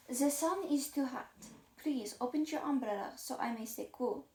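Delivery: put-on haughty dog with a French accent. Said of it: "Maybe I should give him a French accent".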